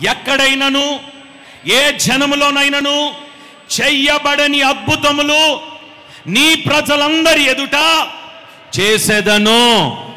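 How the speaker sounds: slow, high, loud scripture reading; solemn proclamation. Acted. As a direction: weigh every word of the promise. Leading congregation reading.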